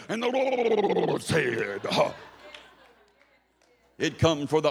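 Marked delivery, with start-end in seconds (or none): 0.1-2.1 very drawn-out, high preacher's cry; Ray Hughes. Acted. Boy playing church imitates a revival preacher, hammed-up preaching. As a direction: hold the words long and high, ending on a gasp. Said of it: "I would do my Ray Hughes impression".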